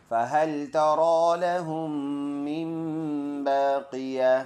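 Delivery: slow chanted verse recitation. Acted. (तिलावत के अंदाज़ में)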